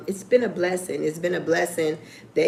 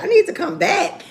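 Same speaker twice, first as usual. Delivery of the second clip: higher, questioning echo of the words; surprised; 11 semitones higher than usual. Retold or imitated. Her mock-surprised reply to the fan, higher pitched. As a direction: echo it back in mock disbelief. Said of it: "I'm like".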